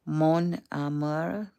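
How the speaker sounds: drawn-out French accent attempt on "Mon amour"; tentative, stretched French accent. Shown as a demonstration. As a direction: try a slow French accent, rising at the end. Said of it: "French accent".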